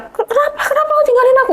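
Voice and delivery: high, fast, hammed-up whiny sobbing plea; mock her old self crying. Imitating her younger self crying at her husband, mock whine. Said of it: "langsung nangis-nangis".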